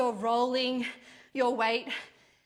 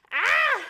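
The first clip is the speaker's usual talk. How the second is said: sharp high cry of effort; strained cry; 13 semitones higher, 8 dB louder than usual. Real, not acted. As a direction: let out a loud strained cry. Straining through final reps.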